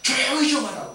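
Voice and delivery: imitated crowd scolding loudly; browbeating scold. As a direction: shout him down to silence him. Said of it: "막 꾸짖고 윽박지를 합니다".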